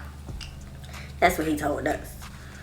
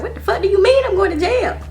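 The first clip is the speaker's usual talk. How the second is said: much higher and louder; outraged yell; 14 semitones higher, 6 dB louder than usual. Retold or imitated. Outraged at being sent to jail. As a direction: demand an answer.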